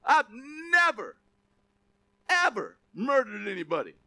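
loud, high, proud boast to God; bragging self-righteous. Retold or imitated. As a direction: puff yourself up before God. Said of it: "or you go and say".

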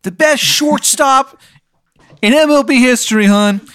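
high, loud, hammed-up Maryland accent; cartoonish Maryland caller, high and pushy; bad Maryland accent. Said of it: "Maryland accent is so bad".

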